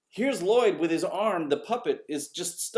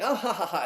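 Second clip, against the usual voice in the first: raised, spelt-out mock laughter; mock audience laugh; 4 semitones higher than usual. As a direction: imitate a crowd laughing openly. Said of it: "they're like".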